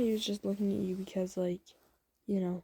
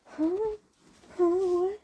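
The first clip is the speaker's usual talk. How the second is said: high, rising huh-what; stunned surprise; 10 semitones higher, 4 dB louder, much slower than usual. Acted. Listener hears friend's love confession, stunned. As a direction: squeak it out in disbelief, pitched way up.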